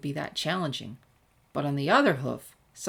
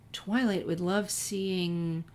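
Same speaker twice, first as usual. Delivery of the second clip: plain reading voice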